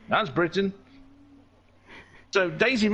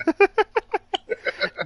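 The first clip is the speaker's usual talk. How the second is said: high-pitched burst of laughter; real laughter. Real, not acted. Host after thanking a donor, high real laughter. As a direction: break into a long high laugh.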